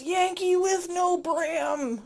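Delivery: high, slow shouted catchphrase; TikTok guy impression. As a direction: yell it slow and high like the meme. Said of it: "He just goes".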